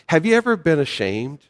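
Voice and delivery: ordinary delivery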